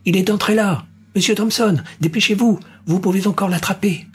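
put-on high, urgent child's voice; child telling Mr Thompson where Reader went, eager. A narrator's reading. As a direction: hurry the hunter like a keen kid. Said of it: "la voix empressée d'un enfant".

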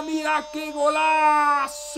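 slow stretched goal cry; goal shout. Acted. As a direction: belt the golazo. Goal call.

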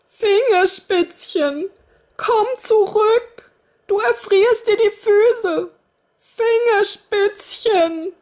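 high, slow, desperate calling; calling after her. A narrator's reading. Kinderfrau ruft dem davonlaufenden Mädchen nach, hoch und laut. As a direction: call after the girl as she runs off. Woman calls after running girl.

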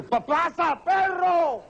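acted desperate holler at a dog; high-pitched shooing call, last word drawn out; stuck man's yell. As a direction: stammer into a high, stretched cry. Man yells at dog again.

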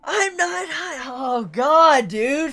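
high anguished wail building to a shout; drawn-out, loud lament at your own feelings; overwhelmed emotional outburst. Real, not acted. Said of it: "Fuck these emotions".

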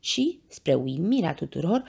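ordinary delivery, nothing marked

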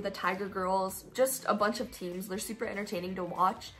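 ordinary delivery

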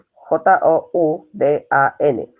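each letter of J U N B I said apart, slowly; spelling out slowly. Shown as a demonstration. Teacher spelling yunbi out slowly for students.

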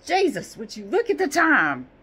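loud startled exclamation; voicing the clock figure. Clock figure shocked at the time, loud exclamation. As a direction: shout it like a shocked man. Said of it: "He's not actually looking at the time".

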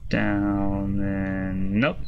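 very slow, stretched words; slow drawn out. Shown as a demonstration. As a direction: draw each word out slowly. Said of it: "slowing the move call".

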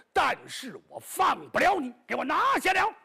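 rising to a high, loud order; commanding shout. Acted. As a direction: bark the order to seize them. Island lord orders the arrest.

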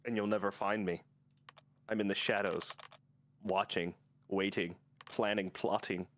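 lightly voiced schemer, low and measured; a hint of villain, pausing between the menacing words; lurking villain. Player taunting rival from the shadows, mildly villainous low voice.

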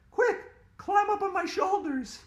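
put-on high, loud bossy girl; urgent girl. A narrator's reading. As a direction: command the friend urgently. Andrea commanding in danger.

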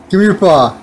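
ordinary delivery

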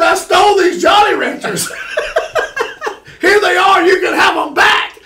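high, loud hammed-up shout; mock wailing confession. Guilty kid returning stolen candy to the storekeeper, mock wailing shout. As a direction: cry it out in exaggerated, laughing desperation. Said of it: "And I'm wailing, crying".

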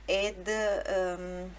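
usual speaking voice

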